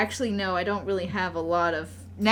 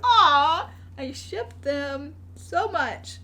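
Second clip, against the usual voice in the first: high "aw" melting into a slow coo; drawn-out adoring gush; 6 semitones higher, much slower than usual. Retold or imitated. Gushing over the cartoon couple.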